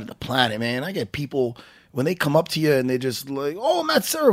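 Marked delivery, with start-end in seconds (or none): 3.6-4.3 put-on Australian fan accent. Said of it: "I can't do any accents".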